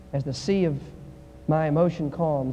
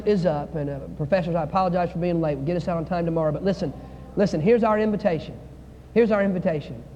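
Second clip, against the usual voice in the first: ordinary talk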